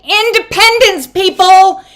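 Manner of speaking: high, loud proclamation; rallying cry. Real, not acted. Reader rallying her viewers with a loud cry. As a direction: shout it to the crowd.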